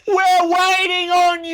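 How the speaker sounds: high, loud, impatient shout; frustrated shout. Real, not acted. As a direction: holler at the TV out of impatience. Yelling at the screen.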